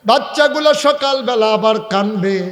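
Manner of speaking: high, loud acted plea; tearful pleading. Old woman worries about her hungry children, tearful plea. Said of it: "মহিলা আবার কান্দে আর কয়".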